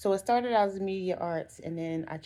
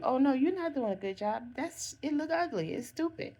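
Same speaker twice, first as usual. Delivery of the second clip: mocking classmate; 8 semitones higher than usual. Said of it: "this person telling you".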